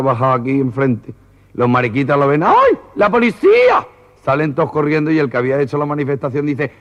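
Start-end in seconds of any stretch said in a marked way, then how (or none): 2.4-3.9 very high, drawn-out mock scream of alarm; hammed-up squeal of fright, loud and slow; panicked shriek. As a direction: shriek it like a comic panicking protester. Demonstrators spot the police, panic.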